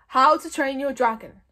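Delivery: loud, weighty title reading; solemn announcement. A narrator's reading. Gobber reading the book aloud to the hordes, solemn. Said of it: "announced Gobber solemnly".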